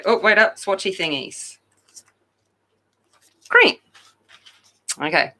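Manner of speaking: ordinary talk, nothing marked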